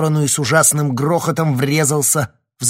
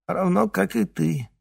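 unruffled villain; 7 semitones higher than usual. A narrator's reading. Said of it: "невозмутимо продолжил Тень".

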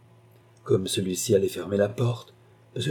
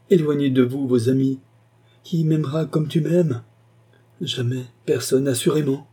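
impassioned, higher-pitched appeal; passionate; 6 semitones higher, 4 dB louder than usual. A narrator's reading. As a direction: cry it out with fervour. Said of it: "avec un accent passionné".

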